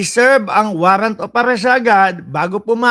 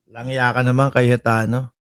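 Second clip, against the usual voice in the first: grumbling jab; 6 semitones lower than usual. Real, not acted. Host jabbing at Cayetano over his claims, low exasperated grumble. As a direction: mutter a fond curse at him.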